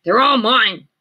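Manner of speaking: loud high possessive yell; grouchy ladybug scream. A narrator's reading. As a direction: shout it like a greedy bug. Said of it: "screamed the grouchy ladybug".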